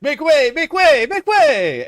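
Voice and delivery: loud, high comic chant; funny shouting. Retold or imitated. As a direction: shout it like a silly herald. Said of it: "in a funny sort of way".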